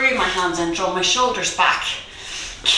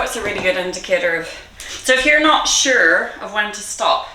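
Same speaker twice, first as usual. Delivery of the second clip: ordinary talk, nothing marked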